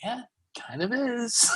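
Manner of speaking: high, slow awkward "kind of is"; sheepish, drawn-out admission; wry reply. Retold or imitated. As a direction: drag it out, half-laughing. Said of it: "I'm like".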